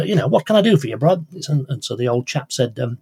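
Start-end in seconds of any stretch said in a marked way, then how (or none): 0.0-1.3 loud, high Australian bloke banter; a hearty Australian greeting, pitched up and loud; Norman's Australian accent. Retold or imitated. Teller voicing the chatty Australian chap greeting the old man in a loud, high Australian accent. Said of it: "a bit of a loquacious Australian chap called Norman".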